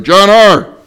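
wife snapping at her husband; wife calling out. Retold or imitated. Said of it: "she's like".

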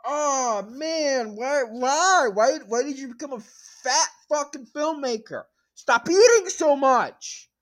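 high, loud wailing outrage; anguished mock rant. Berating an absent filmmaker for his faith, hammed-up anguished shouting. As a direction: wail and berate as if personally betrayed.

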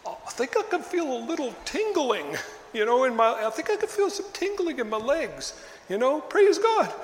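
high, breathy-sounding mock testimony building to a shout of praise; mock fake healing, rising to a high shout; mock faith healing claim. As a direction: parody a sideshow healing, voice climbing high. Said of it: "one of these faith sideshows".

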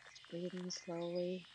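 ordinary delivery

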